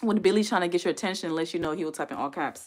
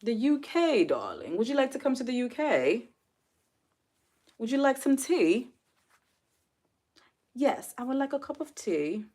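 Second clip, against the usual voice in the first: playful imitation of a British accent, pitched up; mock British host voice, pitched up; 6 semitones higher than usual. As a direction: put on a light British accent to offer tea. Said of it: "you know I can't talk like y'all".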